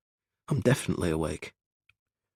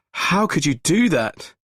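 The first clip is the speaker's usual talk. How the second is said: appalled shout; 10 semitones higher, 7 dB louder, slower than usual. Acted. Gregory appalled.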